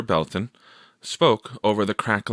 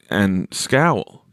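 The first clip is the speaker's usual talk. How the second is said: usual speaking voice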